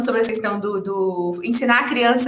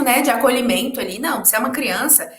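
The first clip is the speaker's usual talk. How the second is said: ordinary delivery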